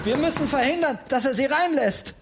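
high-pitched, urgent character voice; urgent alarm. Acted. Bertha urges the boy to stop the Freiherr, urgent. Urgent plan against the door opening.

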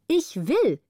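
heftiges Ich will, höher und lauter. A narrator's reading. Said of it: "das heftige". (heftig gerufenes Ich will)

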